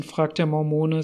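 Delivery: ordinary delivery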